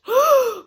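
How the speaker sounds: sharp, high, loud gasp; a sudden gasp of shock. A narrator's reading. Jack sees the valley of nests, loud shocked gasp. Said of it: "he gasped".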